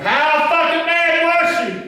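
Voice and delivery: very high, loud, stretched-out holler; slow full-voice chant to rouse the audience; rousing crowd shout. Real, not acted. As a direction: demand a bigger answer from the crowd. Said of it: "you people can do better than that".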